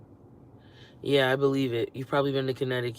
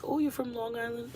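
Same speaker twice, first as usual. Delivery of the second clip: high, soft mock asker; imitating someone mishearing, pitched way up; mock question; 9 semitones higher, 5 dB quieter than usual. Strangers hearing her accent, high mock question. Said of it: "They're like".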